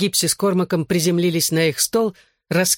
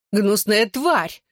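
slow, higher-pitched mild cry; cried insult; 5 semitones higher, much slower than usual. A narrator's reading. Woman attacks the narrator's friend, a mildly shouted insult. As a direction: draw the insult out, pitch up, volume barely raised. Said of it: "крикнула она".

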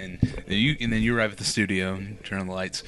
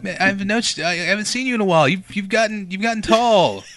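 high, loud, excited surprise; surprised greeting; 8 semitones higher, 6 dB louder than usual. Pretend. [name] greeting Leprechaun Sam in surprise, high and loud. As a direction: greet an old friend you didn't expect.

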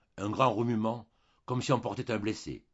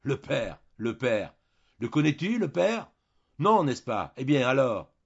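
loud, higher-pitched impatient fury; exasperated, furious; 5 semitones higher, 8 dB louder, slower than usual. A narrator's reading. Julien challenges his wife about the father, furious and exasperated. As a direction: fire it back, patience all gone. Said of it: "comme à bout de patience et furieux".

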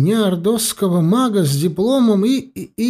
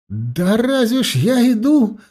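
blurted out; 7 semitones higher than usual. A narrator's reading. Mage blurting to the inquisitor, a slow high outburst. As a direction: burst out slowly and higher. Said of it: "вырвалось у меня".

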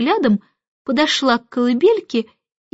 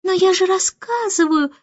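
put-on high-pitched baby voice objecting; protesting; 8 semitones higher than usual. A narrator's reading. Newborn objecting that she is telling, protesting. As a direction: insist in a small high voice. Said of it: "возразила она".

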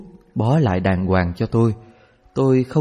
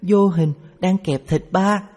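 little daughter; 10 semitones higher than usual. A narrator's reading. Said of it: "Còn tôi nói".

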